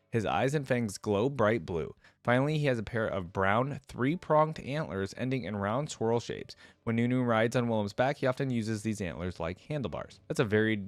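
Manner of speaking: ordinary talk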